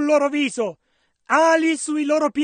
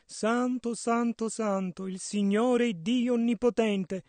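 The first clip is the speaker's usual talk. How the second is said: soft, lowered, gentle murmur; very delicate; 5 semitones lower, 10 dB quieter than usual. Acted. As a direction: say it softly and delicately. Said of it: "devono averlo detto molto delicatamente". (molto delicatamente, a bassa voce)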